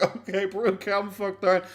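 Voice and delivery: a pretend sarcastic comeback to him; mocking retort. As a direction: roll your eyes and tell him to calm down. Mock reply to him.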